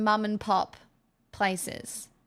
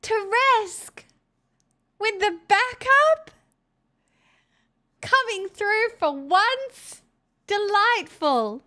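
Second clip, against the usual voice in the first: mock praise; 10 semitones higher, 4 dB louder, slower than usual.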